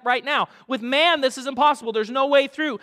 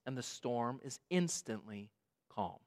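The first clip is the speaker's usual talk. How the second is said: ordinary talk, nothing marked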